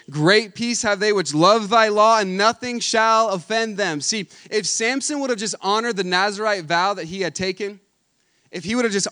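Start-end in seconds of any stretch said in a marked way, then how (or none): 0.1-4.0 slow, louder solemn scripture reading; scripture proclamation. Acted. Preacher quoting Psalm to congregation, slow solemn proclamation. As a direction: proclaim the verse slowly with weight.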